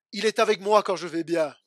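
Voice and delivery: loud declaration of faith; preached affirmation. Acted. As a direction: proclaim the good news firmly. Preached contrast, good times. (affirmation proclamée avec force)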